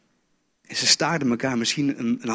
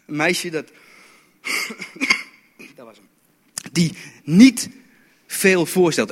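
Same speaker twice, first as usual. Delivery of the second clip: normal talking voice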